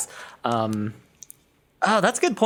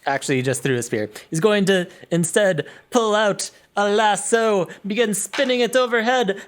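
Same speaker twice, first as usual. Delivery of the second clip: ordinary delivery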